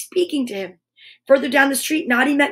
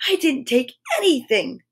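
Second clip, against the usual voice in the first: ordinary delivery, nothing marked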